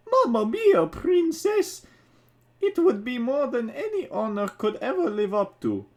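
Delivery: high, bright-eyed Italian plumber voice, well above the reader's own; excited little Mario accepting the proposal. Acted. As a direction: gush it out as a starstruck Mario. Mario voiced in read story.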